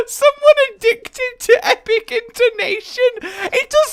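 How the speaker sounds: high, laughing delivery. Real, not acted. Amused at own addiction to epic intonation, laughing. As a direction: say it high through real laughter.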